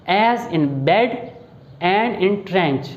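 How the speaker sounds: bed and trench drawn out very slowly for the short e; teacher stretching each word so the e vowel stands out; slow vowel demo. Shown as a demonstration. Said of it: "ज़रा देखिएगा गौर से".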